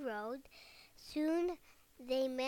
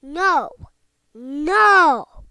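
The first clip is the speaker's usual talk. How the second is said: loud refusal; 3 semitones higher, 21 dB louder than usual. A narrator's reading. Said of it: "the third little pig replied".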